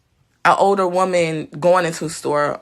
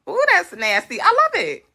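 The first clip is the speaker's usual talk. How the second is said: usual speaking voice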